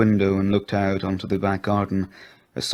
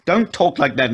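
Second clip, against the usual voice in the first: mild scream; 7 semitones higher, 4 dB louder than usual. A narrator's reading. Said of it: "she screamed".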